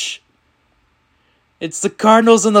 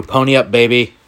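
ordinary talk

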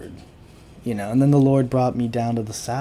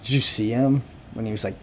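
normal talking voice

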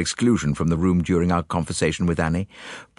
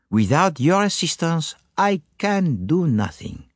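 put-on higher, accented voice with weighty emphasis; courteous French-speaking detective, coaxing; 7 semitones higher than usual. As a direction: flatter the witness with solemn importance. Voices Poirot coaxing the maid.

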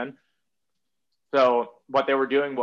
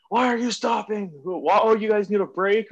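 high-pitched, louder mimicry of an instructor; mock screaming instructor; 9 semitones higher, 4 dB louder than usual. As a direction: jeer at the crew in a high mock yell. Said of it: "get screamed at".

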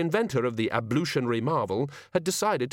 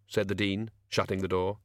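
plain reading voice

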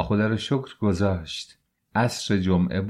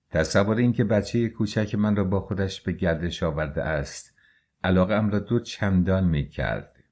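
plain reading voice

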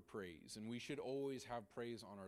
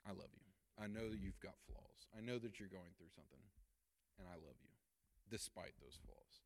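mean every word, softly; sincere; 3 semitones lower, 4 dB quieter than usual. Shown as a demonstration. Talking honestly with a loved one, tender and soft. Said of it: "having a real, honest conversation".

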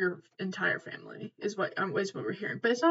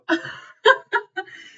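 high, loud burst of real laughter; cracked-up laugh; 11 semitones higher, 7 dB louder than usual. Real, not acted. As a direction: burst out laughing, genuinely tickled. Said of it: "He cracks me up".

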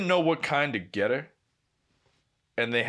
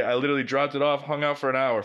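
usual speaking voice

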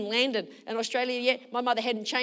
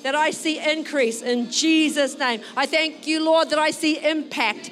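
loud, impassioned church prayer; raised voice, driving prayer; fervent prayer; 5 semitones higher, 8 dB louder than usual. Real, not acted. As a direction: pray aloud over the congregation, full of conviction.

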